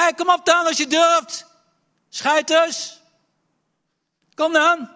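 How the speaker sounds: bellowing high and loud across the valley; a giant shouting insults from the valley floor; giant's taunt. Acted. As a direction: roar a challenge at the enemy camp. Goliath challenges again.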